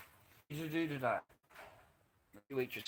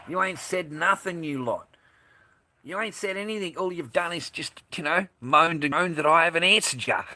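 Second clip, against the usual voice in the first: louder, rising exasperation at the chat; exasperated scolding; 7 semitones higher, 8 dB louder than usual. Real, not acted. Streamer scolds chat for moaning, exasperated and loud. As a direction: tell them off for moaning, voice climbing.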